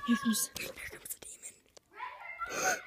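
high, slow frightened whisper; scared whisper. Real, not acted. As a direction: breathe it out terrified of being found. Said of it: "I'm hiding in the closet".

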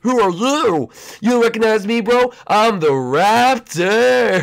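high, loud bro swagger; loud high cocky intro; Raptor voice. Acted. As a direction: boast like a cocky game skin.

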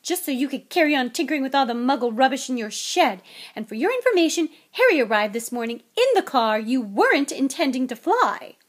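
loud, ranting scolding; furious shout. A narrator's reading. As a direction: keep shouting the accusations at the husband. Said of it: "shouted Missus Weasley".

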